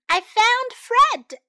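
high, loud, drawn-out announcement; excited cry. A narrator's reading. Sam tells Stella he found Fred, excited cry. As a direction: shout the big news. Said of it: "cried Sam".

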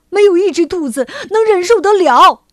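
high, loud outburst; 忍无可忍. A narrator's reading. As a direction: burst out, at the end of your patience. Rabbit can bear it no longer. (忍无可忍地高声喊出来)

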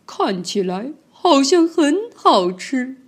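慢悠悠、阴险，"像"字上扬; 不怀好意地打量猎物"看起来好像很好吃"; 贪婪的吉兰泰龙. Acted. 吉兰泰龙盯着猎物. (慢而阴险地说这句话)

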